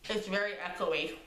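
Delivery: talking through a mouthful of brownie; words round a bite of food; mouth full. Real, not acted. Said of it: "Let me talk with my mouth full".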